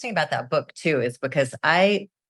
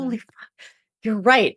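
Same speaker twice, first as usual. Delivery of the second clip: stunned realization; 7 semitones higher than usual. Retold or imitated. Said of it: "And I was like".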